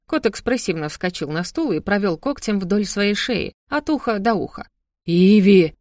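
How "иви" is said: loud angry bellow at his daughter; angry roar. A narrator's reading. Father roars at daughter across the table, angry shout. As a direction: roar her name in anger. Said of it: "сердито взревел отец".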